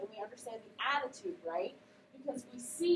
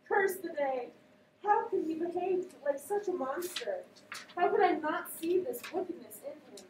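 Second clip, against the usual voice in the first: raised, grieving lines; dramatic reading; 5 semitones higher, 4 dB louder than usual. Acted. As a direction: read the grief with force. Reads the scene aloud.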